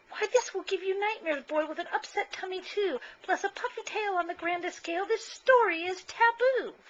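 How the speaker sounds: a fussing mother forbidding the story; shrill scolding mama. Acted. Mama scolds, forbids scary magazine.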